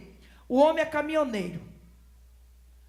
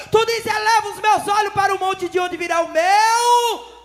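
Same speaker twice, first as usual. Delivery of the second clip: loud, high sermon shout; fiery preaching; 6 semitones higher, 9 dB louder than usual. Acted. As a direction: preach it to the rafters. Preaching at full force. (pregação inflamada e gritada)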